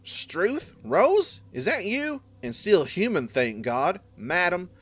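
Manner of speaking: put-on high, loud Southern old man greeting a friend; southern accent. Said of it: "Man, southern accents".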